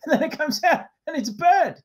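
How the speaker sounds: normal talking voice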